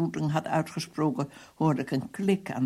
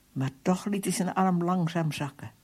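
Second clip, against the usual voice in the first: ordinary delivery, nothing marked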